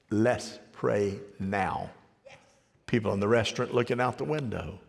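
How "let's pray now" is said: slow, soft prayer call. Retold or imitated. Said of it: "I said".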